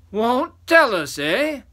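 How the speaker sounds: high, loud, slow taunt; menacing giant. Acted. Third giant challenging the boy, menacing. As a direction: draw it out as a threatening giant.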